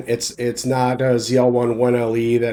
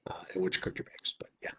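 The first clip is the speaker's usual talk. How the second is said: whispered aside; 6 semitones lower, 18 dB quieter, faster than usual. Real, not acted. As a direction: drop to a breathless whisper for the aside. Aside to co-host, then hands back.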